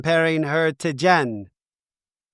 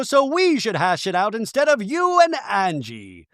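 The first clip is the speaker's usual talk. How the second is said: high-pitched put-on insistence; mock confrontation; 6 semitones higher than usual. Imitating a cast member interrupting two friends, mock confrontation. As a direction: imitate someone butting in, pitched up. Impression of Bronwyn confronting Lisa.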